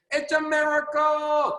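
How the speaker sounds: slow, high, marvelling mentor voice; Jim Rohn's delivery. Retold or imitated. Imitating Jim Rohn for the Zoom team, slow and marvelling. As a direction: draw it out like an amazed old mentor. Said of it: "That's how Mister Jim Rohn used to talk".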